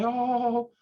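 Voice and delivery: high, whiny mock grovel; over-the-top groveling apology. Shown as a demonstration. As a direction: plead pathetically, hamming it up. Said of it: "you don't have to grovel".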